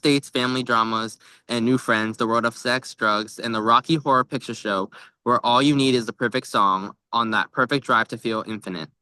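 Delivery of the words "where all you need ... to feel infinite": ordinary delivery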